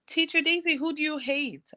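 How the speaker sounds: put-on high childlike voice, innocent question; imitating a little boy. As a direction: play the small boy asking out of nowhere. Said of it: "and he goes".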